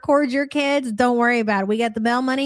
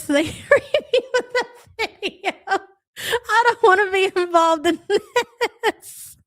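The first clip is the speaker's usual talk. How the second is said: laughing; 10 semitones higher than usual. Real, not acted. Laughing while reading chat.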